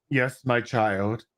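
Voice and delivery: slow, low solemn master voice; lightly voiced wise master, slowed right down; a hint of an old sage; mock wise master. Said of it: "And she's like".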